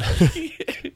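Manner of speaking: sudden high-pitched laugh. Real, not acted. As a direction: let out a high giggle. Host laughs at the joke.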